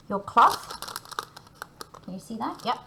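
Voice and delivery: ordinary talk, nothing marked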